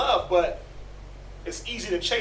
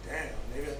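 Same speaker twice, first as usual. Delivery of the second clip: weary exasperation; 7 semitones lower, 11 dB quieter, faster than usual. Retold or imitated. Tired parent at crying baby.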